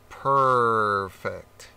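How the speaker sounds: a lazy cat saying perfect; cat's reply. Acted. Cat voiced in story.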